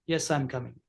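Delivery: low, soft and easy yes; low soft reply. Shown as a demonstration. Teacher demos a calm low reply. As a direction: answer low and relaxed, no harshness. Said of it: "It's a low, low tone".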